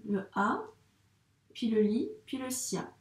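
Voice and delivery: "Le A, puis le li, puis le sia" with a pause after each syllable; syllable by syllable, as for a young pupil; split into syllables. Shown as a demonstration. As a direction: break the name into beats with a pause between each. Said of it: "soit on le segmente en syllabes".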